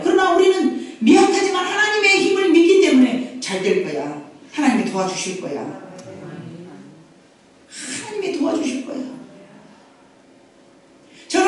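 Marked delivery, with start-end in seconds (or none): 3.4-9.0 soft, hushed words of comfort; quiet reassurance. Acted. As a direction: drop your voice and reassure with quiet faith. Voicing faith-filled self-reassurance.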